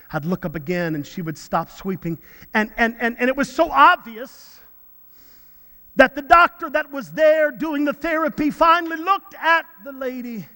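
normal talking voice

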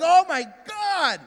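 high, drawn-out dramatic wail; hammed-up alarm at a dire diagnosis; mock panic. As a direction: cry it out slowly, overdramatically. Panics at online health alert.

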